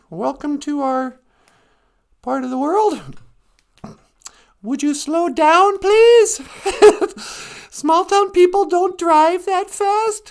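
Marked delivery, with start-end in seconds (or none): 4.6-10.3 slow, louder and higher, pleading with fast drivers; mock scolding. Telling city fishermen to slow down, mock scolding. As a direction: scold them to slow down with a laugh.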